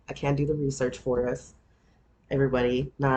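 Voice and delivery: ordinary talk, nothing marked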